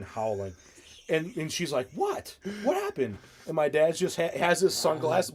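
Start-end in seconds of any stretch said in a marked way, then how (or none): 1.9-3.2 high-pitched, puzzled retold question; puzzled aunt. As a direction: imitate a baffled aunt, pitched way up.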